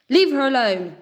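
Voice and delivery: high, loud fierce squawk; eagle's fierce squawking cry. A narrator's reading. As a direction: burst in to the rescue. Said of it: "Leon squawked".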